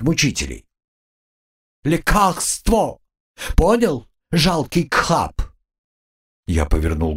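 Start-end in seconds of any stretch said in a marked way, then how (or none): 1.8-5.4 slow, high lisping squeak; slushy lisp. A narrator's reading. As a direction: lisp it like a bossy bug. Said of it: "шепеляво".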